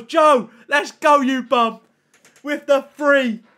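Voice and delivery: loud, high, drawn-out celebration; hyped shout. Real, not acted. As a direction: shout out a donor with stretched words. Hyping a donation from Joe.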